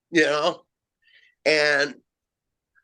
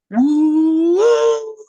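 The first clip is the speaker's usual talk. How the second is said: long high whoop, drawn out slowly, loud; imitated Sasquatch whoop; 6 dB louder than usual. Imitating the Sasquatch call heard in the woods, a long high whoop. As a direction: stretch it out like a distant wild call. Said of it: "A long, sustained whoop".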